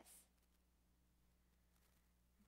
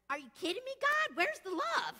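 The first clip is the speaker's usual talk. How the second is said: disbelief; 9 semitones higher, 5 dB louder than usual. Acted. Exasperated plea to God.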